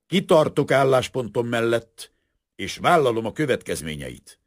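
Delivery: high, loud, resolute declaration. A narrator's reading. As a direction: proclaim it forcefully, like a statement of conscience. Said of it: "emelt hangon".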